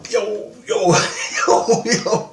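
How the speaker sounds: high mock "yo, yo" in a put-on homie voice; silly high street-kid voice; imitating gangster kids. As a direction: ham up the gangster greeting, high and cocky. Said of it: "they're like".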